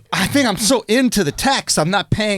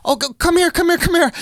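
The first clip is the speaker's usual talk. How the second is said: high-pitched imitation of a fussing girlfriend; girlfriend calling; 9 semitones higher than usual. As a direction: mimic her urging, pitched way up. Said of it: "And my girl goes".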